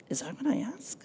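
a hushed, pointed whisper; soft whisper. Retold or imitated. Holy Spirit questioning her excuse, reproachful whisper. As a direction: ask it under your breath, gently reproachful.

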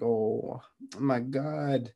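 slow, drawn-out gasp of shock; mock cry out. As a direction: stretch it out like a shocked mother. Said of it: "Miss Weasley cries out".

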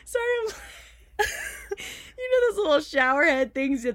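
laughing. Real, not acted. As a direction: giggle through the words. Laughs mid-explanation.

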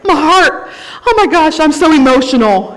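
high, loud, overwrought feeler; mock emotional gushing. Preacher playing the feelers in the church, hammed-up emotional. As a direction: gush it out, hammed-up and tearful. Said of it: "the feelers out there, who's like".

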